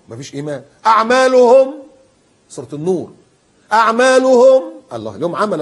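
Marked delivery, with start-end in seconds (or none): 0.9-1.7 1 loud recitation. Real, not acted. Preacher recites verse loudly. 3.7-4.6 1 loud recitation. Real, not acted. Preacher recites verse loudly.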